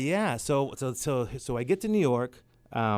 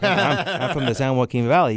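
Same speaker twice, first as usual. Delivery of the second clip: laughing; 2 semitones higher than usual. Real, not acted. Laughing self-mockery.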